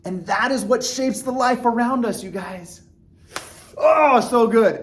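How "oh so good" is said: loud, high, drawn-out delight; real enthusiasm. Real, not acted. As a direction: relish it out loud, slowly. Enthusing about concept at sign-off.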